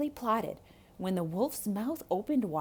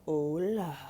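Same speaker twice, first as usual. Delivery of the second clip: sly wolf greeting; 4 semitones lower than usual. Acted. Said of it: "called un lobo".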